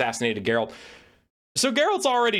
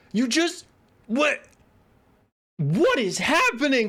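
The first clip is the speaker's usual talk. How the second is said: incredulous; 9 semitones higher, slower than usual. Retold or imitated. Reacting to Geralt's line.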